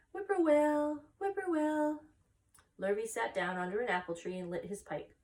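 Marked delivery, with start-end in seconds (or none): 0.1-2.0 high whistled whippoorwill; birdcall. Acted. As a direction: sing it like a distant bird. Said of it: "a bird sang".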